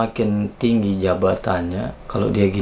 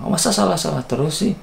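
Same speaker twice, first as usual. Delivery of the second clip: high, fast exasperated scold; mock boss reproach; 8 semitones higher, faster than usual. Boss scolding the employee for repeated mistakes, high quick scold. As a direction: rattle it off like a fed-up boss.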